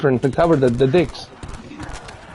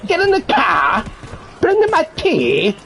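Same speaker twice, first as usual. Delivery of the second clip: imperious plummy grande dame; 7 semitones higher than usual. Pretend. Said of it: "I need to create an accent".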